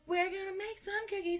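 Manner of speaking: a quiet echo of the line; soft repeat. Real, not acted. Echoing the cookie plan to viewers, hushed. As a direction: drop it down to near a whisper.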